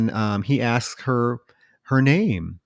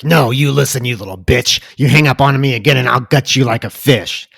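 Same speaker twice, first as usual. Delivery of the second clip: louder, harsher imitation of the killer's snarl; menacing killer threat; 2 semitones higher, 6 dB louder than usual. Killer threatening Casey on the phone, harsh menace. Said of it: "it goes from, like, zero to one eighty".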